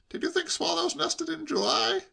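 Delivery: high-pitched doubting question; incredulous nephew. A narrator's reading. Nephew picking at uncle's excuse, incredulous. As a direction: query it in a high voice.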